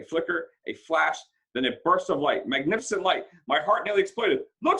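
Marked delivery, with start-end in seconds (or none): 4.6-4.8 shout. A narrator's reading. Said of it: "someone shouted".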